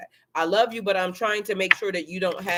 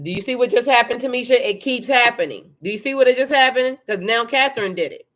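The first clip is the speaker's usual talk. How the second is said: loud, raised and excited, calling to Tamisha; 4 semitones higher, 6 dB louder than usual. Real, not acted. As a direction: push it louder, genuinely amazed. Reacting to the cards, excited.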